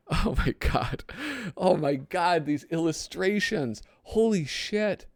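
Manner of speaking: high-pitched awe after a laugh; astonished, rising into a slow high exclamation; amazed. Real, not acted. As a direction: marvel at it, voice lifted. Amazed by the illustrations.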